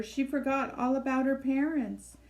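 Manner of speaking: ordinary delivery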